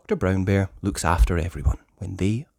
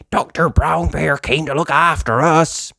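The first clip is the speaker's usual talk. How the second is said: Danny Dog; 7 semitones higher, 5 dB louder than usual. Acted. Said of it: "said Danny".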